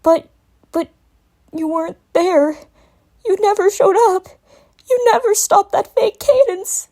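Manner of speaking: high, rising tearful accusation; hurt child voice, building. A narrator's reading. Young dragon tearfully tells Twilight she never came. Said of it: "faint whimper".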